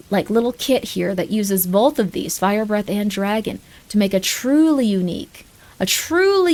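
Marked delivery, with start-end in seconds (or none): none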